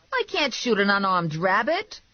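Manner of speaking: high character-voiced objection; incredulous protest. A narrator's reading. Passenger refusing to shoot, incredulous.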